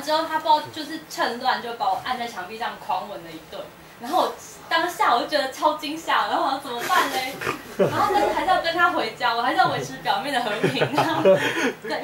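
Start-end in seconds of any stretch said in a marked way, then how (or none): 4.0-12.0 louder, laughing through the words; laughing while telling. Real, not acted. Telling friends about the kiss, laughing. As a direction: giggle as you recount the shock. Laughing at own shocking story.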